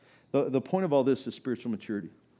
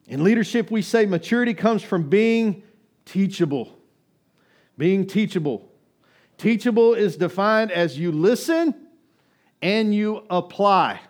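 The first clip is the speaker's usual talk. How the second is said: loud, high, urgent preaching; preaching at full force; 10 semitones higher, 12 dB louder than usual. Acted. Pastor exhorts congregation loudly to spiritual maturity. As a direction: exhort the church to grow up.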